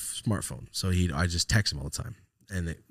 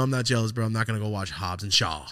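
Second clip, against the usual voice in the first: normal talking voice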